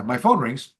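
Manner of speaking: normal talking voice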